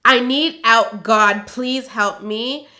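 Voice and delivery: loud, slow, emphatic reading; reading all-caps. Real, not acted. Reading her diary note, she voices the capitals loudly. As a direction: read it like the capitals shout. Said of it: "in capital letters".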